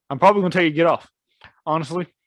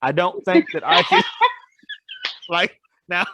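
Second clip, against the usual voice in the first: higher, breaking into laughter; laughing; 5 semitones higher than usual. Real, not acted. Imagining a Scottish accent in bed, laughing. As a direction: try to get it out while laughing.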